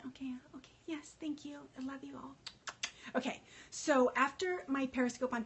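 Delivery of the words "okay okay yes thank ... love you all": much softer, half-whispered thanks; hushed aside. Real, not acted. Answering live comments in a hushed whisper. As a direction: soften right down, just for them.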